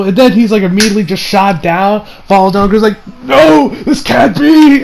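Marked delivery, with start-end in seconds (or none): 3.2-4.8 high, slow, hammed-up cry of defeat; drawn-out theatrical protest; mock dying disbelief. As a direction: cry out as the villain shot down. Acting a shot villain falling down.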